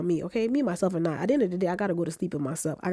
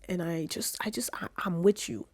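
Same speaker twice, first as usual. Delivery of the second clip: soft whispered reassurance; 4 dB quieter than usual. Real, not acted. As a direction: breathe it to the viewer, tender. Sincere comfort to viewer.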